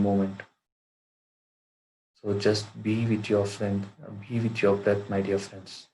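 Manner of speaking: measured meditation guide with long pauses; guided meditation. Acted. Teacher guiding friends to watch their breath, guided meditation. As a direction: lead them into stillness, phrase by phrase, in an even tone.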